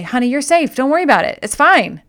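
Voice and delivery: bright dismissive reassurance. Retold or imitated. Reasoning with frightened dog.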